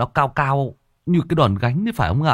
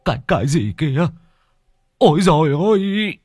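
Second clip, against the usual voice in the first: high stutter rising to a loud wail; stammer, then cry out in horror; terrified old man's stuttered exclamation; stammering fright; 8 semitones higher, 4 dB louder than usual. A narrator's reading. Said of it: "nhè sợi miến ra mà lắp bắp".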